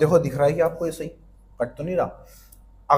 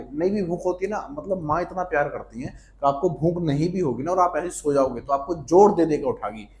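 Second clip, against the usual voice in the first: usual speaking voice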